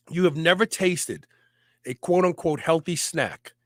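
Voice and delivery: ordinary talk, nothing marked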